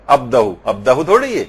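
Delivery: normal talking voice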